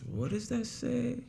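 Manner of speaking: high, soft, slow puzzling over the page; puzzled script reader. Retold or imitated. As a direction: squint at the paper in a small mock voice. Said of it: "It went something like this".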